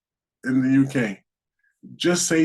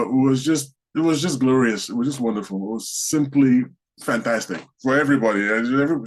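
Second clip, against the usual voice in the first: ordinary talk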